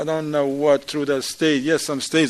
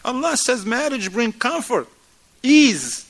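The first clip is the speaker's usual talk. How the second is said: higher, louder mock accusation; mock marital reproach; 9 semitones higher, 4 dB louder than usual. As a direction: scold your spouse, half-acted. Acted quarrel between spouses.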